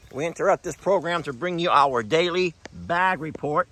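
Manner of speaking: lightly voiced mock announcer; mock news bulletin. Farmer jokingly announcing the daily udder check like a news anchor. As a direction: play it like a newsreader breaking in. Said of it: "time for our daily".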